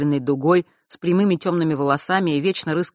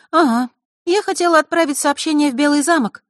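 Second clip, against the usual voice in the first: put-on high, louder girl's voice; girl chiming in; 11 semitones higher, 5 dB louder than usual. A narrator's reading. Diana speaks up to answer Maar, high girl's voice. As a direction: chime in brightly, pitched high. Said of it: "подала голос Диана".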